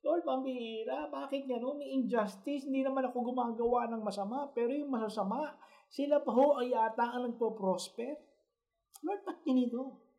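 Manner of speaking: high, soft, tired crying-out to the Lord; voicing the people's weary, high, soft complaint to God; pleading prayer. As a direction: beg God like an exhausted, struggling believer. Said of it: "We are crying out".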